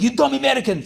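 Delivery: high, angry mock yell in a foreign accent; Ukrainian professor yelling. Professor scolding his class, angry mock yell. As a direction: bark it like a furious foreign professor. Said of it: "He's like".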